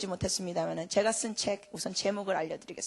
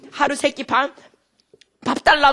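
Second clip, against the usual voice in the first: demanding; 4 semitones higher, 10 dB louder than usual. Retold or imitated. Quoting people demanding meals.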